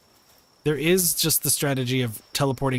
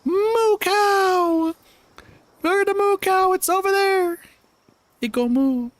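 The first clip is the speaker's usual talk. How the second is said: put-on squeaky toddler voice, far higher and louder; silly babytalk; 4 dB louder, slower than usual. Streamer spots a cow in the game, squeaky toddler voice. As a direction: squeal like a little kid spotting a cow.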